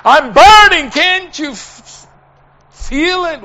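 high, drawn-out yell; imitating a dying man's loud plea; top of his voice. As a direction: cry out in retold agony. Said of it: "The top of his voice".

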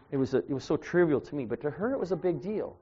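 normal talking voice

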